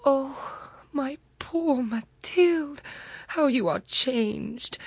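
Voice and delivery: high, slow, stricken cry of dismay; friend's high, shocked cry on seeing her worn old friend. Acted. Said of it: "Her friend uttered a cry".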